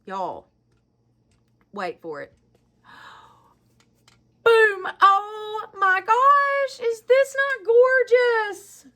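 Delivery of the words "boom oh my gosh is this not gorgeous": much higher, slow, stretched words of awe; real awestruck excitement; gasping delight. Real, not acted. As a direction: gasp and marvel at the reveal. Unveiling second gift.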